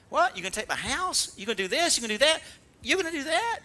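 high, incredulous taunting back; mocking retort. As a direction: laugh off the devil's threats. Mocking the devil's threats.

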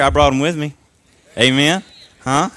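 ordinary talk, nothing marked

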